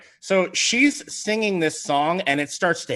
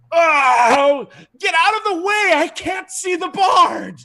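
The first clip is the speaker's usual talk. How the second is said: high, loud anguished shout; wounded, upset creature; 7 dB louder, slower than usual. Acted. Wounded foe on the floor to whoever blocks his view, anguished shout. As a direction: yell it out in strained frustration. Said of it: "and he goes, he goes".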